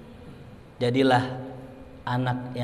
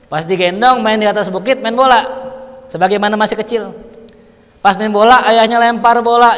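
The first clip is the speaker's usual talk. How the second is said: high, loud preacher build-up; fervent storytelling; 9 semitones higher, 12 dB louder than usual. Acted. Preacher rousing the congregation with the story, fervent. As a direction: drive the story home to the congregation.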